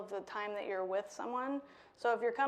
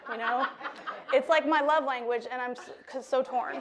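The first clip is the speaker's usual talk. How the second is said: embarrassed laugh, louder; laughing at own cursing; 6 dB louder than usual. Real, not acted. Embarrassed about cursing near kids.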